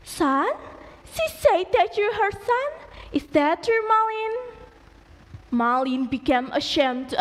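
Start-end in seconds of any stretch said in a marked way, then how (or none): 0.1-4.3 shocked wife. Acted. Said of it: "Malin's wife shocked and said".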